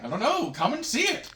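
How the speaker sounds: high, loud, carefree invitation; breezy invitation. Retold or imitated. Professor inviting students to see his artifacts, breezy and high. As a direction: pitch it right up, tossing off the invitation.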